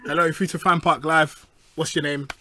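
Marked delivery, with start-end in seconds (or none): none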